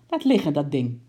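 loud, stern order; loud command. Acted. Uncle orders the penguin to drop the coat, loud. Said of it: "riep hij geschrokken".

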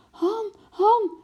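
very high, loud cry of alarm; acted panicked shriek. A narrator's reading. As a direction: scream for the husband. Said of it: "gilde ze".

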